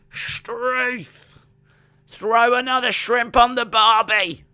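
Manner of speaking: put-on high, loud clichéd Aussie accent; bad Australian accent. Cheering Australia's qualification in a hammed-up Australian accent. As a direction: lay on a cartoon Australian accent. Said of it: "that was the worst Australian accent ever".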